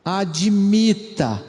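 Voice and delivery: Admita drawn out slowly; weight every syllable of Admita; slow emphasis. Shown as a demonstration. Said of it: "verbo muito importante".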